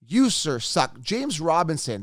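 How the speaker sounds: ordinary talk, nothing marked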